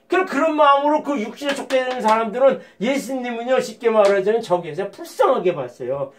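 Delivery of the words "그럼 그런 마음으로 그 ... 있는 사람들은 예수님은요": normal talking voice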